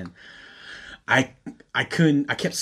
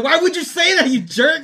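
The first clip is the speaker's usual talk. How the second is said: high, loud mock protest; retold hurt outrage; 13 semitones higher, 6 dB louder than usual. Telling off a tactless friend, mock-wounded outrage. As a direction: cry out indignantly at a betrayal. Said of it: "I was so mad at him".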